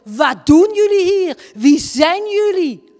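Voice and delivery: loud, high, suspicious challenge; hostile old woman. Retold or imitated. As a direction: demand to know who these strangers are. Old woman confronts the visitors.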